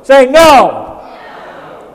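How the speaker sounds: high, full-force rallying shout; emphatic shout. Shown as a demonstration. Preacher rousing the congregation to say no, emphatic shout. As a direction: shout it like you truly mean it. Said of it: "Now say it like you mean it".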